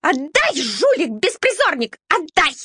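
high, squealing demand to hand it over; shrill squealing little dog. A narrator's reading. Little dog Shaiba screeching at the puppy, high and shrill. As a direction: screech like a spiteful little mutt. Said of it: "увидела пряник и заверещала сразу".

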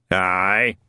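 a low rumbling "Aye"; rumbling old man. Acted. Shadwell answering the phone with a low rumble. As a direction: grumble it like an old raincoat. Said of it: "a voice the colour of an old raincoat rumbled".

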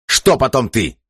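high, angry interruption; barked. A narrator's reading. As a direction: cut in with an angry bark. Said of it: "рявкнул Джерри". (гневно рявкнуть)

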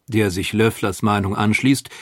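ordinary delivery

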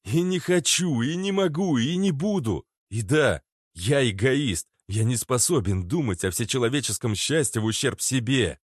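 loud, high, emphatic protest; heated refusal. Acted. (горячий протест)

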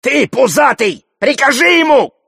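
high, loud demanding yell; Алёш. A narrator's reading. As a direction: shout an order across the room. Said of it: "Алёш кричит Зухуру". (требовательный крик)

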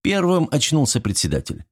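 usual speaking voice

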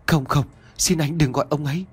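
high, urgent frightened plea; panicked pleading. A narrator's reading. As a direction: beg him in panic not to tell. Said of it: "Trông vẻ mặt hoảng hốt đến tột cùng".

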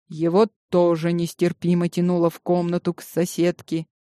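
usual speaking voice

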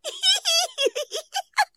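high-pitched evil cackle; high villain cackle. Acted. Karabas sinister laugh.

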